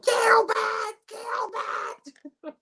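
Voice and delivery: very high mock screech; stupid villain voice. Mocking the Candyman's voice to his co-host, high silly screech. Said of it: "it has that stupid voice".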